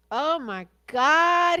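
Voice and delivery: high, loud burst of glee; a delighted squeal at catching him out; amused exclamation. Real, not acted. Host gleefully reacting to Warren talking boats, loud high squeal.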